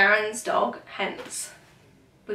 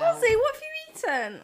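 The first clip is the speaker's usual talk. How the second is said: high, shocked, to the dog; high alarmed question. Real, not acted. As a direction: scold the dog in surprise. Dog ate something.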